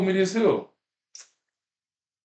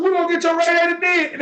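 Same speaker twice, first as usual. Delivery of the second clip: excited shout; 9 semitones higher than usual. Real, not acted. To his friends, shouting his flush advice. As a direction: shout it out to drive the point home.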